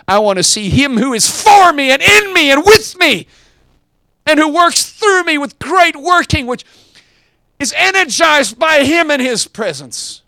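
hammering preacher's climax; preaching at full force. Real, not acted. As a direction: build to the sermon's climax.

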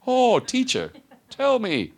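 very high, loud, syrupy fawning; two-faced flattery. Acted. Pharisee fawning at Jesus with mock sweetness. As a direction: overdo the sweetness falsely. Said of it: "How about that for two-face?".

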